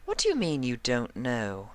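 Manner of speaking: high, refined lady voice; prim, fussy fashion pony; Rarity voice. A narrator's reading.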